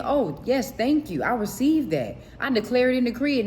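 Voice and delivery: bright, lifted, grateful declaration; joyful acceptance. Retold or imitated. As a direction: receive it gladly and declare it. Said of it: "You need to be saying".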